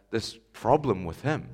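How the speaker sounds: ordinary delivery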